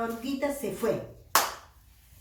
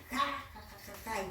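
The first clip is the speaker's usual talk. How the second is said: mock ha-ha tailing off softer; acted rabbit laugh; 3 semitones higher, 5 dB quieter than usual. Rabbit laughs after boasting of its jumping, mock laughter. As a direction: play the boastful bunny chuckling. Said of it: "y se ríe".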